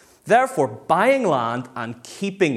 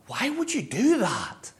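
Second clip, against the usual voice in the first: high-pitched, drawn-out incredulous question; mock-astonished onlooker; baffled disbelief; 8 semitones higher than usual. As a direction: question it as if utterly bewildered. Society baffled at selling land.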